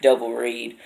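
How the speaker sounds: ordinary delivery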